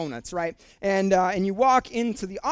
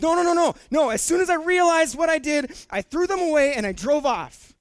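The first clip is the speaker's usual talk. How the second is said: friend protesting; 6 semitones higher, 3 dB louder than usual. Retold or imitated. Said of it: "He goes".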